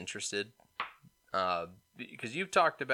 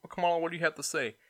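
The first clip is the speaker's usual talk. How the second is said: mock news anchor; faster than usual.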